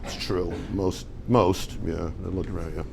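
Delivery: a low, soft mock mumble of agreeing listeners; mumbling audience. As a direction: imitate the crowd nodding along under its breath. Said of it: "because I see everyone going".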